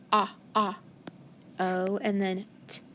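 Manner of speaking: ah, ah then O and t whispered softly; sounding out. Shown as a demonstration. Teacher whispering the sounds in got. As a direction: isolate each sound, quietly. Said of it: "So I hear".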